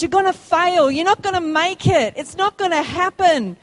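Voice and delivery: voicing the taunts of hard times; screaming circumstances. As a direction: throw the doubts at them loudly. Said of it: "It screams".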